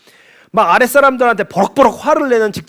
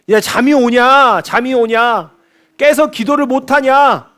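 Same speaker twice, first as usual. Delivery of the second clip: slow, higher-pitched drawn-out reproach; acted scolding; 5 semitones higher, slower than usual. Anxious Jesus to sleeping disciples, acted scolding. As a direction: stretch each reproach, acting out exasperation. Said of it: "타박하시는 거죠".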